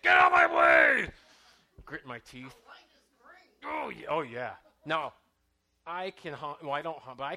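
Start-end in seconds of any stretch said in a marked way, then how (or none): 0.0-1.1 lower, much louder shout through the windshield; mock road rage yell. Preacher acting out the congregation's road rage: mock shout. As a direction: bellow at the driver ahead. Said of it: "Maybe you're like".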